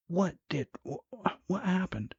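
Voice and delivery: high, breaking off and restarting; put-on high female voice, flustered; alarmed wife. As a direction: stammer the question in a high woman's voice.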